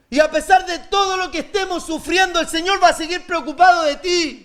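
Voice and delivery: fervent pulpit voice, Dios leaned on; preaching at full force. Acted. Preacher to congregation about suffering, fervent preaching. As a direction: lift the congregation, pushing each phrase.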